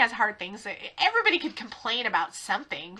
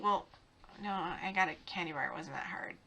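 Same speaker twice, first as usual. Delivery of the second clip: lower, much softer self-talk; sheepish kid thinking; 7 semitones lower, 15 dB quieter than usual. Retold or imitated. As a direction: mumble like a kid catching himself. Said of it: "he'd start to think".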